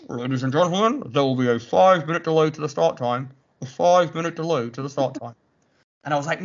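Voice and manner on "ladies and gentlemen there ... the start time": imitating a run director on a megaphone; megaphone announcement. Run director announcing a delay, megaphone style. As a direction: announce it like a tannoy. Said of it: "his little megaphone".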